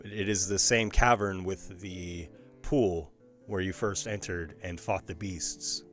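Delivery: ordinary talk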